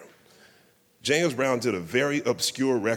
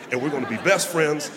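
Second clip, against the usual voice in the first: building from excited to a much higher, much louder near-shout; giddy excitement; 6 semitones higher, 7 dB louder than usual. Retold or imitated. Fan imagines riding into the sunset with [name], building to a giddy shout. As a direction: dream bigger and bigger until it bursts out. Fan fantasizing about his hero.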